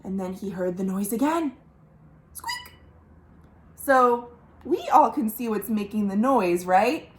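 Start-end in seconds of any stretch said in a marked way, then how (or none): 2.3-2.7 tiny high squeak, softer; a very high, soft mouse squeak. Acted.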